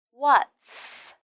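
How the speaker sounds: "What's" with a long, clear s at the end; final s. Shown as a demonstration. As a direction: sound out the closing s of "What's". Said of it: "don't forget the final s sound".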